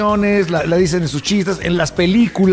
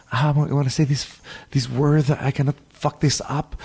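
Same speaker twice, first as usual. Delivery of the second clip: quiet, anxious English aside; nervous English; 4 semitones lower, 5 dB quieter than usual. Retold or imitated. Will Ferrell bracing before the word, hushed and tense. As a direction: psych yourself up softly before the risky word. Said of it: "Se pone todo nervioso".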